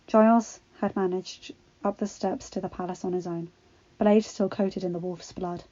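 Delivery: ordinary delivery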